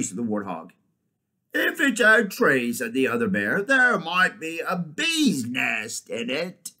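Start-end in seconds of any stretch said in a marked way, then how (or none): 1.5-2.7 high, loud, slow bear voice; eager bear. Acted. Other bear figure in story. 3.6-6.7 slow, higher bear voice; hopeful bear. Acted. Other bear figure in story.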